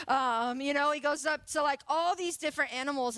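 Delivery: ordinary delivery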